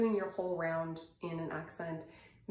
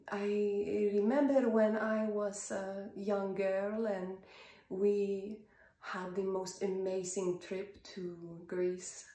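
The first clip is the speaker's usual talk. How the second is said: Greek accent. Shown as a demonstration. Coach demonstrating a trip memory, in a Greek accent. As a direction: reminisce in a vague European, Greek-like accent. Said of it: "maybe European or Greek".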